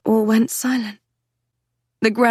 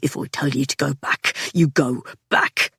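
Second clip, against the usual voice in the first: stern low man voice; 5 semitones lower than usual. A narrator's reading. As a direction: lay down the law. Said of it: "bitten out through the cage of his teeth".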